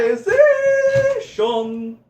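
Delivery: very high, slow squeak; silly high voice. Pretend. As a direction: squeak it out slowly.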